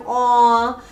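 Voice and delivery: high tender coo. Retold or imitated. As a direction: coo over something cute. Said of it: "It's like".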